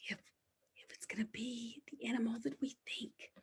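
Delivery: hushed whisper building suspense; secretive whisper before turning the page; excited whisper. Acted. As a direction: whisper to the kids before the reveal.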